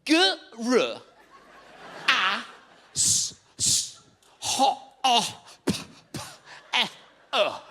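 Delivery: drawn out, broken-up letters; glitching Zoom voice. Retold or imitated. Assessor on a buffering Zoom call, letters stretched. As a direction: spell it like a frozen video call.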